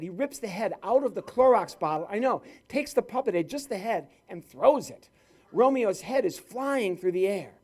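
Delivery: normal talking voice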